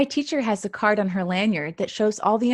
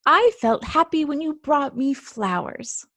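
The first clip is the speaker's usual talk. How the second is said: higher, fond grandma; 6 semitones higher than usual. Acted. Grandma telling Mouse about the flowers, fondly. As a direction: answer like a sweet grandma.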